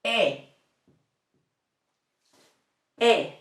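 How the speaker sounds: short pure E like in men; clipped E, no glide; Spanish E. Shown as a demonstration. Teacher modelling the Spanish vowel E. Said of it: "The second one".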